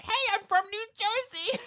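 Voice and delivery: put-on very high squeaky New Jersey accent; New Jersey rat voice. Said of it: "and he's like".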